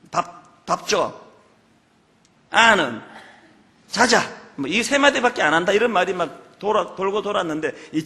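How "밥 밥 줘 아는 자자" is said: 무뚝뚝한 경상도 남편처럼 짧게 툭툭; a terse Gyeongsang man's clipped words; gruff Gyeongsang husband. Retold or imitated. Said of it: "딱 세 마디만 한다". (무뚝뚝한 경상도 사투리)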